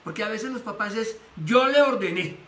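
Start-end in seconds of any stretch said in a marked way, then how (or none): 1.5-2.4 louder, higher authoritarian command; bossy father. Retold or imitated. Father asserting authority over his son, stern and loud. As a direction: lay down the law as the dad.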